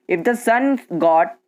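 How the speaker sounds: usual speaking voice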